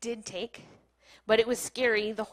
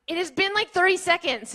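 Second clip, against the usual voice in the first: higher, louder exasperated reply; retold raised-voice protest; exasperated retort; 8 semitones higher, 7 dB louder than usual. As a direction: snap back at an impatient husband, raised voice. Retold exasperated reply to impatient husband.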